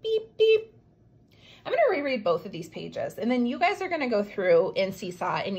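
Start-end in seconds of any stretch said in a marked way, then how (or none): 0.0-0.7 high robotic "Beep, beep". Acted. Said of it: "twin robots".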